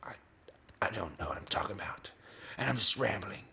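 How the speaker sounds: breathy whisper under the music; whispered aside. Real, not acted. Whispering to viewers that he's rambling. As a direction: mutter it to yourself, half to the mic.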